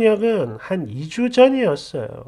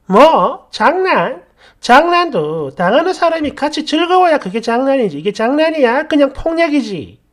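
incredulous, higher, heated retort; raised-voice indignant argument; angry shouting back; 4 semitones higher, 4 dB louder than usual. Acted. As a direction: throw the word back in outrage. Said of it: "저도 같이 질렀죠".

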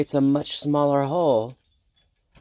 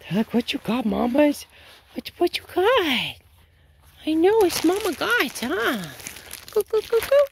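pet talk. Real, not acted. Feeding oats to her chickens, very high cooing pet talk. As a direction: coo at them like they're babies.

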